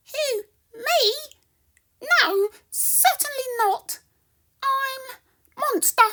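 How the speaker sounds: high, loud squeaky mouse voice. Acted. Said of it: "squeaks Mouse".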